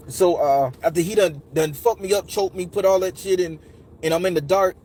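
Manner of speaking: ordinary delivery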